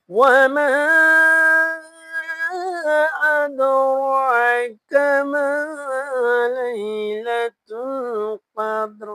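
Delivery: loud, drawn-out chanting; loud slow recitation. Shown as a demonstration. Teacher reciting the verse loudly for the class. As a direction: chant it out slowly and fully. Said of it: "আবার বলেন".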